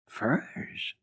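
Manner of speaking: breathy scornful whisper. Acted. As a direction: hiss the word in disbelief. Rottenmeier scornful echo.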